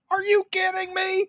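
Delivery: high-pitched incredulous cry; high squeal of disbelief; incredulous exclamation. Retold or imitated. Shouting his reaction at the movie.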